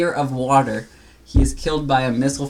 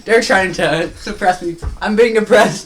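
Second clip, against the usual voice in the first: loud, laughing fake protest; laughing mock outrage; 6 semitones higher, 7 dB louder than usual. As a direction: complain loudly through laughter.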